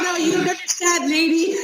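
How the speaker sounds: high-pitched, insistent retold protest; in denial. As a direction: imitate yourself refusing to believe it. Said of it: "And I was in denial".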